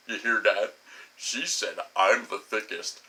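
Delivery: low, goofy boastful voice; Herman Munster. Retold or imitated. Tapping a stranger on a park bench, goofy boasting. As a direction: brag like a big oaf about to laugh. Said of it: "I'm like fucking Herman Munster".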